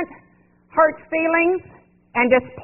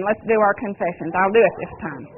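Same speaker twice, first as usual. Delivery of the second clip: usual speaking voice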